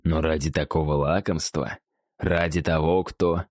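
a cavernous creature voice from deep inside; booming echoing voice. Acted. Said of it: "прозвучал гулкий голос Сорнита из недр его туши".

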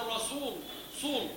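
رسول with a heavy emphatic sad, صول; mock emphatic sad. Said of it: "يجعلونه صادا". (تقليد نطق السين صادا في رسول)

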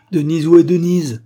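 full, high, very loud call; crying out. A narrator's reading. Freed husband looking for his wife, loud cry. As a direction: shout for her urgently. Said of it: "s'écria-t-il".

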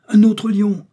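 very high, loud cry of alarm; startled high shout. A narrator's reading. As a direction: shout a sudden danger warning. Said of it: "s'écria Joey".

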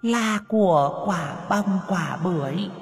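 a furious spirit shrieking a riddle; hissing ghost. Acted. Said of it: "rít lên".

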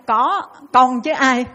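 slow, loud, pointed answer; nun bluntly tells the woman she is the one, slow and loud; blunt nun's reply. Retold or imitated. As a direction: set each word down deliberately, pointing at her.

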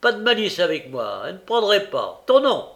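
loud, high-pitched browbeating; bully the accused with sharp volume; hectoring interrogation; harsh magistrate. A narrator's reading. Magistrate bullies the accused boy.

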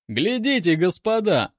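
high, eager cornet's exclamation; exclaiming. A narrator's reading. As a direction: call out excitedly to the others. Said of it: "воскликнул корнет".

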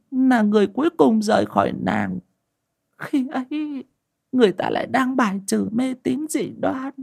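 high, tearful confession of a secret; a wife confessing through tears, voice high; high-pitched woman admitting she is a witch. Acted. Wife's long confession.

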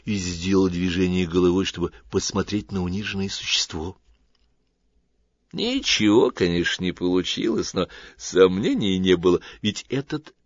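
plain reading voice

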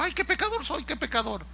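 high, soft mock wail of a sinner; mock remorse. A convert lamenting as the oil falls on him, mock remorse.

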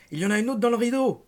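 high, loud alarmed cry; shouted warning. A narrator's reading. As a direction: shout out the danger. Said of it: "cria-t-il".

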